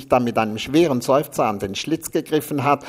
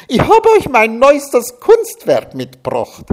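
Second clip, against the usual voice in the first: much higher, louder gushing squeal; a shrill-pitched proud announcement, much louder; 13 semitones higher, 10 dB louder than usual. A narrator's reading. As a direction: trill it out like a proud diva. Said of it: "jaute Liesel".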